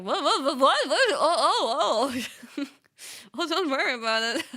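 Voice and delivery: loud, rising startled whats; flustered whats. Retold or imitated. Streamer caught off guard by Vivi's apology, loud flustered whats. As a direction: sputter in confused surprise. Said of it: "I was like".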